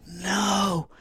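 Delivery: high panicked cry of no; retold cry of no. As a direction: cry out no in sudden alarm. Sister cries out at flatline.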